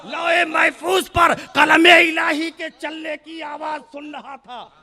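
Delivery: impassioned sermon shout; fervent preaching. Acted. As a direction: swear it out to the crowd. Oath-backed sermon climax.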